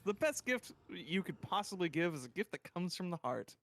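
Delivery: usual speaking voice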